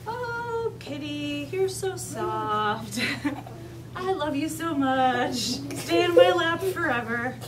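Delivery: slow, cooing adoration of a kitty; Ethan doting on his cat. Pretend. Teacher pretending to be a boy cuddling his cat, doting coo. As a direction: gush lovingly over a pet, drawn out with a giggle. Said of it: "I'm gonna pretend I'm Ethan".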